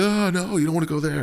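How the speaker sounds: high-pitched mock panic; hammed-up high wail of alarm; freaking out. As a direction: warn them off in an exaggerated fright. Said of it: "everyone starts freaking out".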